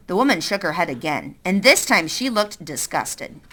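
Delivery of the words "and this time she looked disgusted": plain reading voice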